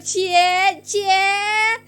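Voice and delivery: high, loud jeering whoop; drawn-out playground tease, hollered; teasing yell. Acted. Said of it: "You just go like".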